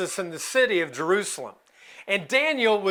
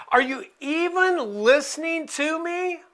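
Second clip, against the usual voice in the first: higher, pleading cry of are you there; desperate plea to God; 7 semitones higher than usual. Retold or imitated. Daniel crying out to an unanswering God, pleading higher. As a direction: reach up, begging to be heard. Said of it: "He's like".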